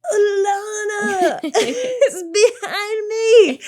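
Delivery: very high, loud, drawn-out wail; slow mock shout, stretched and straining; strained garbled call. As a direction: imitate desperately getting someone's attention. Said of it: "look like I'm having a fucking stroke".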